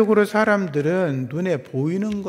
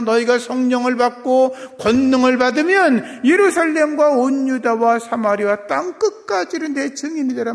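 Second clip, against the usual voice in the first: high, loud pulpit proclamation; fervent preaching; 8 semitones higher, 7 dB louder than usual. Real, not acted. Preacher relaying Jesus' promise to the disciples, fervent preaching. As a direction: proclaim it with full force.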